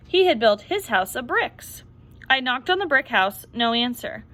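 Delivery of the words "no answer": ordinary delivery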